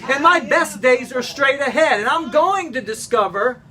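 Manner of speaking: rally them to believe in themselves; preaching at full force. Acted. Pastor urging the congregation, rousing preaching.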